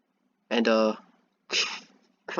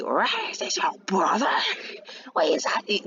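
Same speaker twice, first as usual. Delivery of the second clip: shocked; 5 semitones higher than usual. Acted. Acts everybody shocked at the news.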